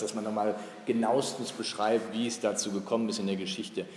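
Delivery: ordinary delivery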